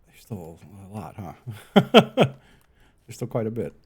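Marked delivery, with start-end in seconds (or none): 1.4-2.4 sudden loud laugh. Real, not acted. Laughs at how far is left.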